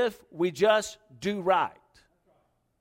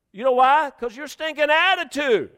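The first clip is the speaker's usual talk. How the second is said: loud, high-pitched telling-off; scolding; 7 semitones higher, 7 dB louder than usual. Retold or imitated. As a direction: let the rude driver have it. Officer telling off cussing driver.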